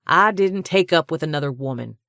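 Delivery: loud, hot denial; fired back. A narrator's reading. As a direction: snap the denial right back. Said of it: "he fired back".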